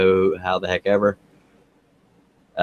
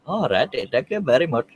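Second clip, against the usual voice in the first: wobbly, swooping comic voice; silly put-on voice; 3 semitones higher than usual. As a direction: do a goofy character thank-you. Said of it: "the voices are starting".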